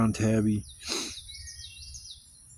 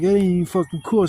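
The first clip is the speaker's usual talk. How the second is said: raised complaint; indignant; 6 semitones higher, 4 dB louder than usual. Retold or imitated. Scolding the dog owner, raised indignation. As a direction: protest at the owner. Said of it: "It's like".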